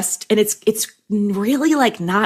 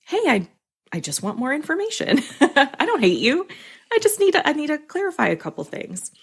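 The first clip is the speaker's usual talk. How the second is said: higher, gentle reassurance; light, lifted, harmless-sounding request; calming, friendly voice; 7 semitones higher than usual. Shown as a demonstration. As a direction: reassure the writer as a kindly editor. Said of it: "how they actually intend it to be heard".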